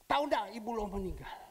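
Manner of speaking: indignant accusing question; angry scolding. Retold or imitated. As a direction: scold him for not knowing. Angry woman scolding pastor.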